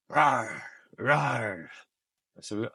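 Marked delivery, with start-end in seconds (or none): 0.1-1.7 director's imitation lion roar; demonstrated lion roar. Casting director showing how to be a lion, mock roar. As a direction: roar the word out as a demo. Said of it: "I'll show you. And he goes".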